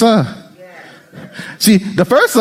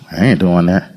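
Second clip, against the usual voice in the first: low inner refusal. Acted. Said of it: "I'll go".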